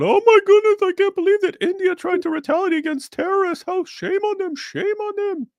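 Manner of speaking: very high fussy put-on voice; mocking protester. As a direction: squeal it like an outraged protester. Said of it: "you're not gonna see protests".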